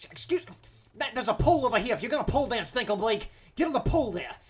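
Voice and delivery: loud, high call across the space. Pretend. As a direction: holler instructions at someone. Calling out to Blake.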